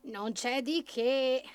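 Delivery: slow, drawn-out self-satisfied character voice; gloating rabbit. A narrator's reading. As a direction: stretch it out, pleased with yourself. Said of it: "gongolò il coniglio". (coniglio che gongola)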